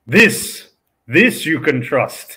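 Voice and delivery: slow, high, loud solemn proclamation; mock solemn. Guest jokingly hailing the old D&D book, slow solemn. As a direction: raise the book like scripture and proclaim.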